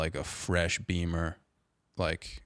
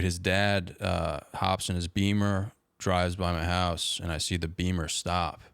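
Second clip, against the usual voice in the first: normal talking voice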